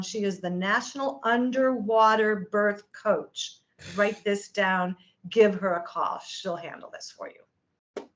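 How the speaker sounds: slow, measured, emphatic directions; deliberate instruction. Retold or imitated. Friend giving the birth coach's number, slow and deliberate. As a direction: hand it over slowly, point by point.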